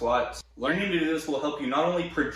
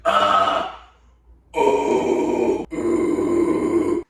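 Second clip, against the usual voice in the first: raspy scream sliding lower; low false chord scream; 4 semitones lower, 6 dB louder, much slower than usual. Shown as a demonstration. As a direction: drop the growl lower. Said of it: "so you'll go from".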